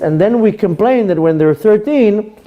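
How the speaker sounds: ordinary talk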